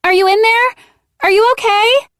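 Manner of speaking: high, loud, anxious call through a door; worried call. A narrator's reading. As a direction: shout to a friend behind the door. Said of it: "she called".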